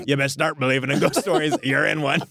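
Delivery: mock a pirate warning of ghostly doom; Pirates of the Caribbean pirate. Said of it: "sort of Pirates of the Caribbean".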